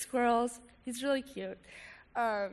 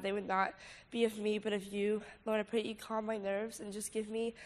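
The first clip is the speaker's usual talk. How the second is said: prayer; 5 dB quieter than usual. Acted. Leads a prayer.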